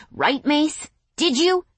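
high, taunting fake curiosity; mock curiosity. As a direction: taunt him with fake interest. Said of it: "with mock curiosity".

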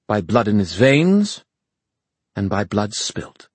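ringing, formal defiance; proud fairy woman. Acted. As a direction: proclaim the rightful king before an army.

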